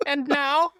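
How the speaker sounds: very high gleeful threat; high demon voice. Retold or imitated. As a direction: gloat like a squeaky demon. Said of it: "continuing the demon impression".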